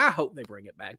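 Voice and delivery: ordinary talk, nothing marked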